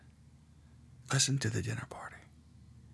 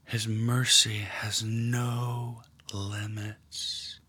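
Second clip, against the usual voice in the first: guided meditation; 5 dB quieter than usual. Acted. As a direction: draw them gently into the scene. Leads a guided prayer meditation.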